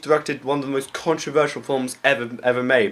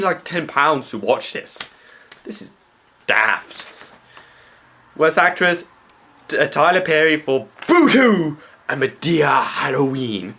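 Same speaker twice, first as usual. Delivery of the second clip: ordinary talk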